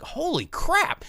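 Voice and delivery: high, drawn-out astonishment; stunned high exclamation; amazed reaction. Retold or imitated. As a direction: stretch it out in mock awe, way up high. Voicing an amazed viewer reaction.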